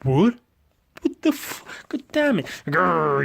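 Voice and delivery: high, sputtering, furious cries; angry outburst. Acted. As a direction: blow up in disbelief then growl. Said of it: "he's fucking angry".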